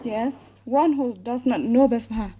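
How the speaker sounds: usual speaking voice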